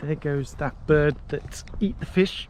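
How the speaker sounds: usual speaking voice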